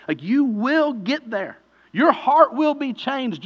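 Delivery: loud, high, impassioned preaching; preaching at full force. Acted. Pastor urging the congregation, loud and soaring. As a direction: lift the room with the promise.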